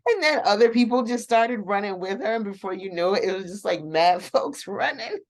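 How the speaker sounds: high, giggly retelling; barely holding back the laughs; laughing while telling. Real, not acted. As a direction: talk through bubbling laughter. Cracking up retelling funny scene.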